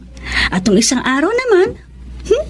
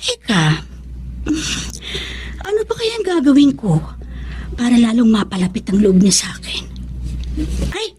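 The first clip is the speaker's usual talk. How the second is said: whisper; 5 dB quieter than usual. Acted. Scheming thought spoken to self.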